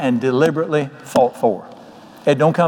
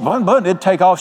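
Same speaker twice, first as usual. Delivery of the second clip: ordinary talk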